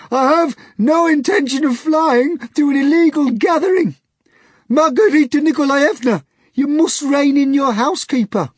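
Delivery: high, loud pompous protest; indignant hog. A narrator's reading. The hog begs Margarita to rein in Natasha, pompous. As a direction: complain with offended dignity.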